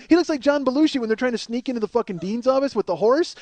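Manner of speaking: normal talking voice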